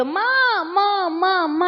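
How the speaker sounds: high, crying call for mother; a little boy wailing for his mother; child calling out. Retold or imitated. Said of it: "అని పెద్ద పెద్ద కేకలేస్తున్నాడు". (అమ్మను పిలుస్తూ ఏడ్చే పిల్లవాడు)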